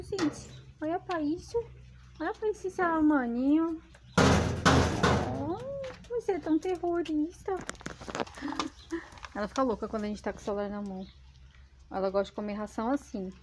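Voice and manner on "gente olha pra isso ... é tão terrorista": high doting pet-talk voice; baby talk to dog. Acted. Owner teasing her dog as a terrorist, high baby talk. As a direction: gush over the naughty puppy.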